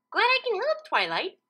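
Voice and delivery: high, bright Pinkie voice; smiling Pinkie. Acted. Pinkie happily tells Twilight she was glad to help. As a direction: beam as you say it. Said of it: "Pinkie said with a smile".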